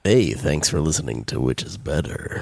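low, husky seductive voice; sexy sick voice. Shown as a demonstration. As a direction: purr it low and sultry. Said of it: "say some sexy things".

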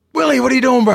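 high, loud call from a car. Retold or imitated. Friend calling from the car to Willie, loud surprised call. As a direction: shout it out the car window, surprised.

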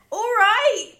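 high, loud mock posh British voice; posh British accent. Shown as a demonstration. Friends reading an accent guide, mock posh British. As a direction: put on a posh British drawl, laughing. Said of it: "How to speak in a British accent".

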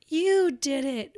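put-on a child's high, slow, loud accusation; mock angry child. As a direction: play the sulky kid pointing the finger, slow and loud. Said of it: "she was very mad at me".

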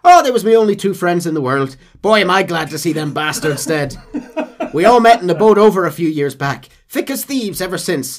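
high, loud chuckling Irish brogue; gleeful comic Irish rambling; Irish accent. Retold or imitated. Irishman telling John about dead friends, Irish accent.

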